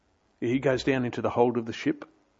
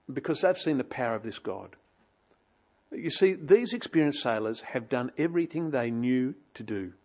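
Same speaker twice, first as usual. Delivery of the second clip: normal talking voice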